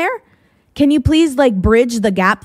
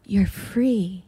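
soft, airy fairy godmother granting a wish; 2 semitones lower, 8 dB quieter than usual. Pretend. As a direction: whisper it like a magic spell. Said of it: "as though I'm like a fairy godmother".

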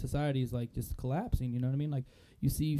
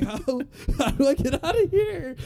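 much higher, loud, laughing plea; cracked-out bum; 12 semitones higher, 10 dB louder than usual. Retold or imitated. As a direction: beg to get out, dazed. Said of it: "asking him, like".

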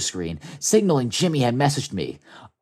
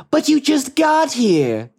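high, drawn-out exclamation; startled protest; 11 semitones higher, slower than usual. Acted. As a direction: blurt it out in dismay, stretched high. Acted startled protest in story.

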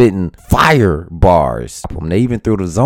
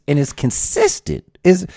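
ordinary delivery

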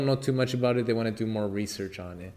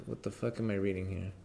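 muttered to himself, much softer; half-swallowed frustrated aside while high; stoned muttering; 3 semitones lower, 9 dB quieter than usual. Real, not acted. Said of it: "I'm high right now".

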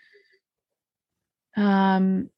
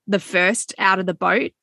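usual speaking voice

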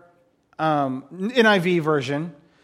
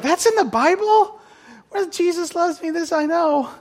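high-pitched, incredulous imitation; hammed-up shocked listener; mock disbelief; 10 semitones higher than usual. Preacher voicing churchgoers shocked by the gory story, incredulous.

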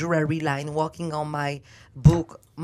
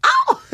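sudden high-pitched "ow"; sharp, piercing yelp; high loud yelp; 11 dB louder than usual. Real, not acted. Guest fed gold by host, high loud yelp. Outburst while being given gold.

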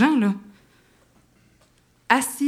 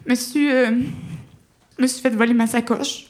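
half-sobbing plea, a little higher; retold sobbing; 3 semitones higher than usual. Stranded traveller tells airport clerk her bag was stolen, teary. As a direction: choke it out through tears, lightly. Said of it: "entre l'accent puis les sanglots".

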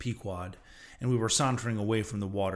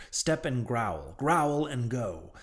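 slow, deliberate; much slower than usual. Acted. Stranger quotes Ahab's rule.

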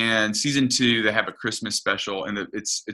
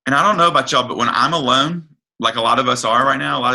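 impassioned preaching; 5 semitones higher, 7 dB louder, faster than usual. Real, not acted. Preacher builds to congregation.

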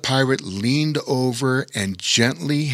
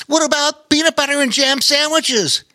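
put-on squeaky little-kid voice, much higher; eager curious child, pitched way up; high childlike Bobo Chico voice; 4 dB louder than usual. A narrator's reading. Bobo Chico asking Pete eager questions, high childlike voice.

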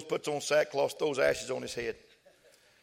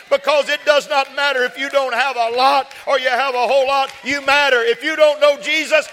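building from loud to a shouted climax, much higher and louder; preaching at full force; 12 semitones higher, 14 dB louder than usual. Acted. Pastor rallying the congregation that everyone matters, building to a shout. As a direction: preach it, swelling to a roar.

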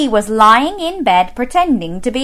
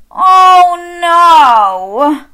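higher, louder exasperated bear voice; fed-up bear; 8 semitones higher, 8 dB louder than usual. Acted. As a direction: groan it like a sleepless bear. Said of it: "said Mister Bear".

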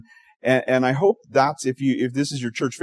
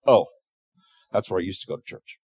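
deadpan punchline; 4 semitones lower than usual. Acted.

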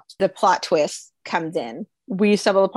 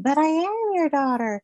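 put-on old lady voice; 9 semitones higher than usual. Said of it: "then she's like".

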